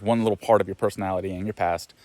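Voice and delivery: ordinary delivery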